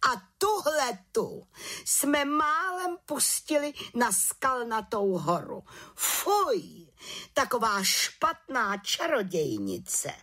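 high, slow indignant exclamation; high-pitched, disgusted head witch; scandalised outcry. A narrator's reading. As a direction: cry out in outrage as head witch. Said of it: "najednou pohoršeně vykřikla".

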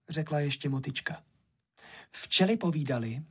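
plain reading voice

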